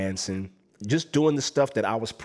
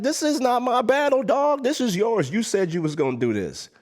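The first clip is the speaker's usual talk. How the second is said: high, panicked protest, louder; pushing the battle back in a panic; panicked plea; 13 semitones higher, 4 dB louder than usual. Retold or imitated. Panicked quote when challenged.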